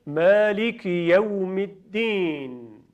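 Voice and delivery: very slow, «یوم» as yawm; slow model recitation. Shown as a demonstration. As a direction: lead the students slowly.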